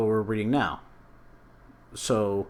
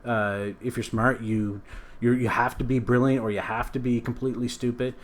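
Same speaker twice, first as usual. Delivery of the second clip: normal talking voice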